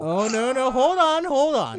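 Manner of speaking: high, loud plea to wait; urgent calling out. Real, not acted. Reader cuts off friends' groans, urgent calling out.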